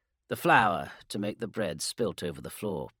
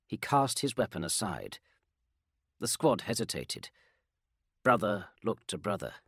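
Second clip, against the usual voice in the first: ordinary delivery, nothing marked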